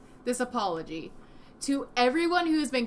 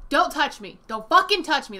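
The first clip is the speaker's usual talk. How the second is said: angry rebuff; 3 semitones higher, 7 dB louder than usual. Acted. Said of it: "That's exactly what this means".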